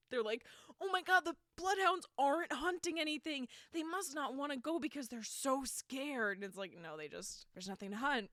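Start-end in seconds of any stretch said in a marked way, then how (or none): 0.8-6.4 mock panic. Mocking the townsfolk, hammed-up panic about the bloodhounds. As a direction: gasp it out like a silly scared local.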